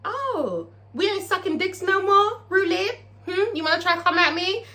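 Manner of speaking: high, taunting call-out; confrontational. Acted. Brazil calls out Roulette for a fight, confrontational.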